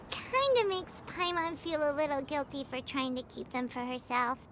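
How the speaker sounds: low, hushed sheepish aside; guilty mumbling. Acted. Guide admitting she wanted the gifts, guiltily quiet. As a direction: confess it softly, sinking in pitch.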